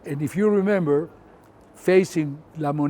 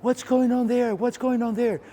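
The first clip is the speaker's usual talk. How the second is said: nervous soldier asking; 8 semitones higher than usual. Retold or imitated. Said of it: "And he says, asking me".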